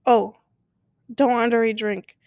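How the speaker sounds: ordinary talk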